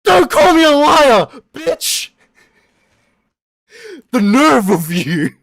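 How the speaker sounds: high, screamed mock indignation; hammed-up screaming outrage; outraged shout. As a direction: explode at the accusation, loud and high. Acted outburst at being called liar.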